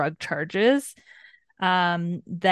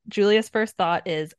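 usual speaking voice

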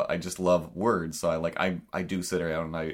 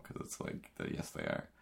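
unvoiced aside, much softer; whispered aside; 12 dB quieter than usual. Real, not acted. Muttering back at royals, whispered aside. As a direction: drop to a breathy whisper.

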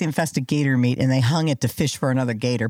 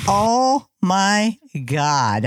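very slow, raised oh-my-God; mock shock; 4 semitones higher, much slower than usual. Retelling own reaction to the show, drawn-out mock shock. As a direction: draw each word out in acted disbelief.

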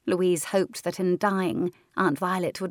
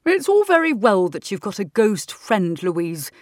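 louder stern schoolteacher; teacher voice; 7 dB louder than usual. Acted. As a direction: scold like a tired teacher. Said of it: "Mister Cunningham had said".

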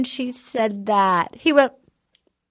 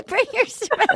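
higher, laughing through the threat; 8 semitones higher than usual. Pretend. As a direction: break up laughing mid-line. Laughing repeat.

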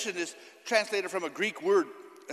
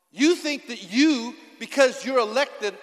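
loud, slow, hammering each phrase; forceful preaching; 2 semitones higher, 6 dB louder than usual. Acted. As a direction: preach it to the congregation.

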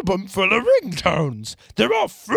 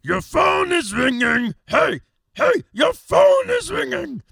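ringtone shout; 10 semitones higher, slower than usual. Pretend. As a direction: yell it like a ringtone. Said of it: "listen to a few of the ringtones".

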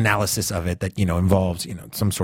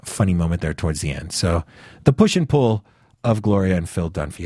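ordinary delivery

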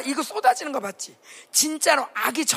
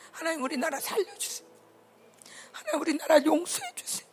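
tearful prayer; 6 semitones higher than usual. Real, not acted. Preacher leads tearful prayer.

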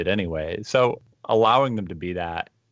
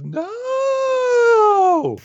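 very high, long drawn-out loud wail; hammed-up high howl of protest; mock wailed protest; 7 dB louder than usual. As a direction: wail it out in an exaggerated falsetto. Acts out the professor's horrified reply.

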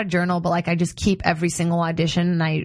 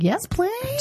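eager high yes please. Retold or imitated. Eager reaction to free facial.

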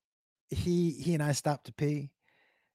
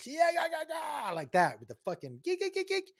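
high honking goose imitation; goose honks; 13 semitones higher, 5 dB quieter than usual. Imitates geese honking at his dog, high. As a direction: honk high like charging geese.

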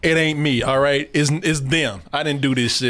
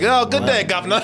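mock British accent; 5 semitones higher than usual. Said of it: "before I heard the accent".

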